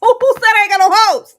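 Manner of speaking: sassy loud comeback in character; voicing Michelle's loud retort; loud mock Michelle. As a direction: fire back like you have options too. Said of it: "Michelle say".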